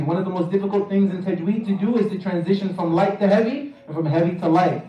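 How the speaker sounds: usual speaking voice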